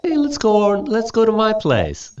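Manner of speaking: high, chummy invite; friendly invitation. Retold or imitated. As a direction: invite a friend over casually. Sounds like something you would say to a friend.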